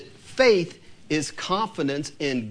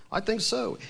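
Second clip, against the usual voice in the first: ordinary talk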